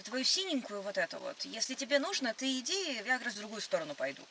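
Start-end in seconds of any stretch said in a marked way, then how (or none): none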